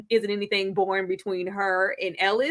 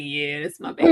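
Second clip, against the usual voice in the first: lower, proud mother voice; proud mom voice; 9 semitones lower than usual. Retold or imitated. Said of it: "she all proud, like".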